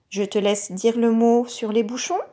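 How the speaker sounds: ordinary talk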